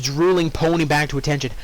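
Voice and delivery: ordinary delivery, nothing marked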